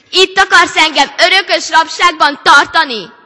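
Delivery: loud, high, fearful and angry cry. A narrator's reading. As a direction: shout into the darkness. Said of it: "kiáltotta". (félve és dühösen kiáltva)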